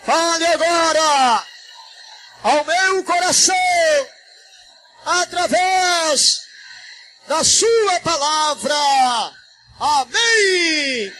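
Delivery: high, slow, drawn-out cries; shouted prayer. Shown as a demonstration. Pastor leading the church in shouted prayer, high and stretched. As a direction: shout it phrase by phrase for echoing. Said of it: "Grita comigo".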